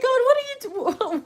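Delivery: hammed-up startled prayer; mock prayer to God. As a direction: squeak it up to heaven.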